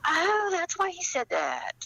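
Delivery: high, bright aha of discovery; realization. Retold or imitated. Writer realizing why her character spoke, delighted aha. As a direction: voice leaps up as it clicks. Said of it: "and I go".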